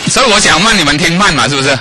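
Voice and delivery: high, loud teasing; playful joke. Acted. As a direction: tease the listeners with the logic. Joking with congregation.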